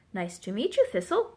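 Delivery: plain reading voice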